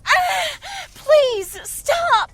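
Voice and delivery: high loud begging cries; pleading cry of pain. Acted. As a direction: beg through the pain. Clawed by the demon.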